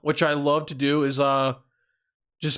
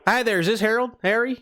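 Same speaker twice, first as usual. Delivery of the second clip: put-on Southern accent. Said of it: "we're doing the Southern accent".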